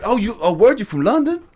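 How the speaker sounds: hammed-up Brooklyn local thrilled by a London accent; squealing American delight at a British visitor; mock amazed New Yorker.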